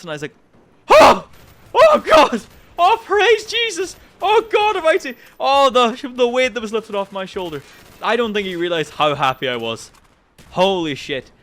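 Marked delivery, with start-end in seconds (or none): 0.9-7.6 high, loud cries of praise, easing off at the end; overjoyed wail, descending as relief sinks in; ecstatic relief. Retold or imitated. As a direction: squeal it like a hammed-up hallelujah. Acted huge relief at passing.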